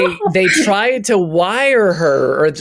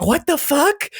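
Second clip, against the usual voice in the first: confused grandma; 13 semitones higher than usual. Retold or imitated.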